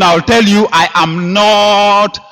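slow, deliberate insistence; slow emphatic. Acted. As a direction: lean on every word.

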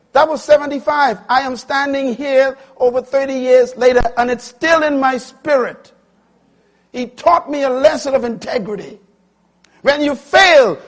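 high, loud, sustained pulpit cry; full-force preaching. Acted. Preacher passing on a lesson of integrity, full-force preaching. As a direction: preach it at the top of your voice.

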